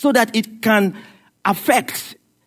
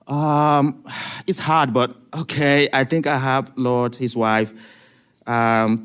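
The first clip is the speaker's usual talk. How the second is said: lower, reluctant God; 8 semitones lower than usual. Acted.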